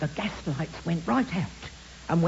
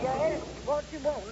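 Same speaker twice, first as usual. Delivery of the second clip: distant, softer voice down a tube; through a speaking tube; 6 semitones higher, 5 dB quieter than usual. Acted. Housekeeper answers Holmes through the speaking tube, distant and muffled. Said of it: "on the speaking tube".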